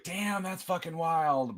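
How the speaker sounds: slow drawn-out disbelief; stunned disbelief. Retold or imitated. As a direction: savor the shock. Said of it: "you're laughing 'cause, like".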